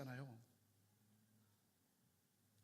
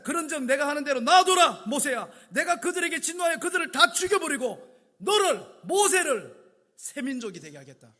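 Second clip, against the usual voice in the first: loud, higher booming verdict; wrathful decree; 6 semitones higher, 13 dB louder than usual. Acted. God telling Moses to stand aside, booming and wrathful. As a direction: thunder it down from above.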